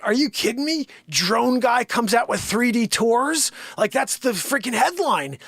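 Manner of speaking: can't believe they'd bury it; incredulous outrage. Retold or imitated.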